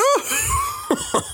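high shouted mocking laugh; mocking frog laugh. Frog creature laughs Jamie off, high shouted laugh. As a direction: cackle at the boy's question.

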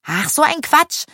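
high-pitched scoff; dismissive. A narrator's reading. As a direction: brush off a silly idea. Dismisses the werewolf joke.

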